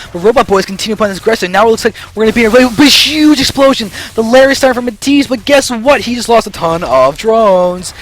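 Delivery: loud, high-pitched battle commentary; excited play-by-play. Real, not acted. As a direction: shoutcast the explosion at full tilt. Caster calls upgrade and explosion.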